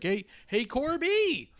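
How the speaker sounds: high-pitched mock hail; eager calling out. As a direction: call after someone, voice leaping way up. Imitates Sammy calling out to Corbin.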